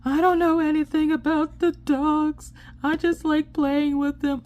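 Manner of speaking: high, whining kid imitation; upset child. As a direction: plead like a tearful little kid. Upset child pleading in the story.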